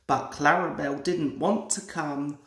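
plain reading voice

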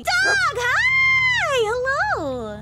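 high, loud excited greeting; excited shout. Real, not acted. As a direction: squeal hello at the dog.

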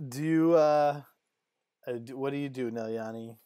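ordinary talk

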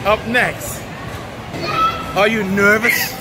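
usual speaking voice

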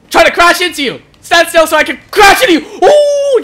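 agitated, high-pitched exclaiming; frantic excitement. Real, not acted. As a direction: cry out mid-chase, ending in a high whoop. Chasing an opponent to crash.

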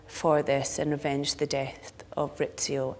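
ordinary talk

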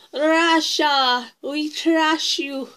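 imitating her Russian accent, slow on the last words; mock Russian accent. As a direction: drag out we trash you in a put-on Russian accent. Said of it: "And she goes".

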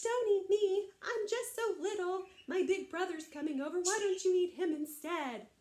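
high, pleading little voice; little bitty goat. Acted. Littlest goat begging the troll to spare him, high pleading. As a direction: plead as a tiny frightened goat. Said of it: "the little bitty billy goat".

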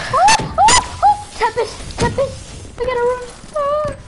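high panicky yelps; panicked. Real, not acted. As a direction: flee in a panic. Being chased in game.

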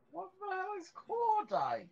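high, incredulous question; baffled. Real, not acted. Friend baffled by a strange gun word, high incredulous. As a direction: blurt it out in disbelief.

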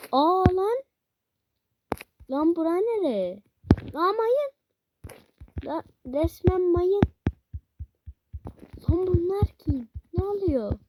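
high, loud startled exclamations; surprised. Real, not acted. Gamer shocked entering a mine-filled level. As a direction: react in shock to a strange place.

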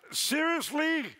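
drawn-out disbelieving cry; stunned husband; incredulous. Retold or imitated. Shocked reply to wife.

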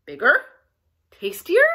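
loud, rising troll questions; crying out greedily. A narrator's reading. Troll hears of bigger goat, cries out. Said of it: "he cried".

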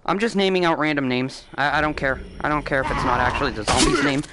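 ordinary talk

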